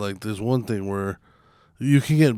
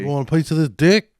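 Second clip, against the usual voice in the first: tough guy voice; 6 semitones higher, 5 dB louder than usual. Retold or imitated. Mock tough-guy threat in game.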